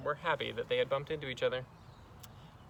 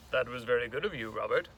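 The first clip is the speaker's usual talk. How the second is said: restrained teacher recovering formality; understated, formal thanks; composed teacher; 3 semitones lower, faster than usual. Acted. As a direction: pull back to polite reserve after gushing. Teacher collects herself after gushing.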